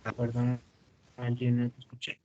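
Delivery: bad connection, words dropping; glitching audio. Real, not acted. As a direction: voice cutting in and out. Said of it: "[name], te estás yendo". (voz que se corta)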